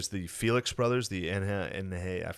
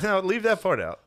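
exasperated; 10 semitones higher, 5 dB louder than usual. Acted. Mocking the article.

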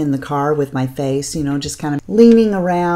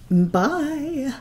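sing-song bye; 10 semitones higher, 3 dB quieter than usual. Real, not acted. High sing-song sign-off.

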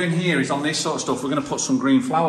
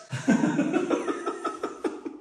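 high-pitched laugh; 13 semitones higher than usual. Real, not acted. Laughing at joke.

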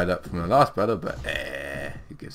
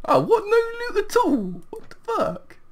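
much higher squeal of outrage; can't believe there's no loot; shocked disbelief; 4 dB louder than usual. Real, not acted.

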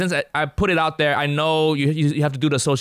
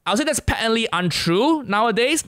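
ordinary talk

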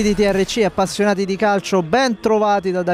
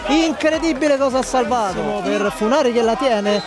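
fast, high, rising match call; breathless italian goal commentary; 5 semitones higher than usual. Real, not acted. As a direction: build the excitement to a peak. Near-miss chance in highlights. (telecronaca concitata e incalzante)

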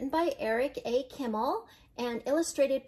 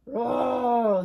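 loud storybook bear roar; 7 dB louder than usual. A narrator's reading. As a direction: let out a big, drawn-out roar. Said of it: "Old Bear, uh, roared".

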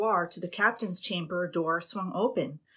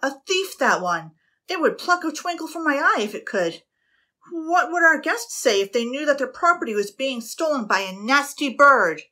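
loud, higher roar of outrage; captain raging at the crow; 6 semitones higher, 7 dB louder than usual. A narrator's reading. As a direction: bellow at the thieving bird. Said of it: "The captain roared".

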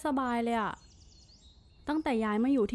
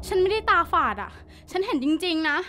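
frightened; 3 semitones higher than usual. Acted. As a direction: insist in a scared rush. Wife frightened after seeing shadow.

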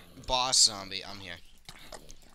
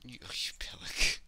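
whisper; slower than usual. Real, not acted. Laughing insult whispered.

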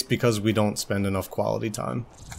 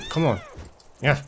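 normal talking voice

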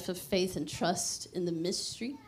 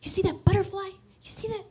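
much higher sing-song parent voice; high cooing voice to a small daughter; talking to toddler; 11 semitones higher, 3 dB louder, faster than usual. Retold or imitated. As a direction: coo at a little girl about a butterfly. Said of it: "I talk to our little girl".

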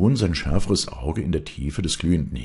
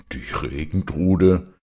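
Regentrude repeated slowly and harshly, a touch louder; hard repetition; 3 semitones higher, 4 dB louder, slower than usual. A narrator's reading. Farmer scoffs at the widow's rain spirit, hard and slow. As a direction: throw the word back at her with scorn. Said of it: "wiederholte der Bauer hart".

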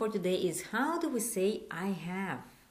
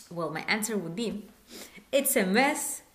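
ordinary talk

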